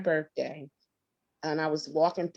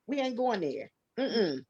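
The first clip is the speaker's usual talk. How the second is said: usual speaking voice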